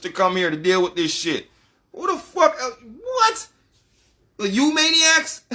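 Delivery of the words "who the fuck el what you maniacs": squeaky high disbelieving alien; a mocking higher voice looking down on humans; mock alien outrage. Alien sneering at humans asking for a visit, mock incredulous.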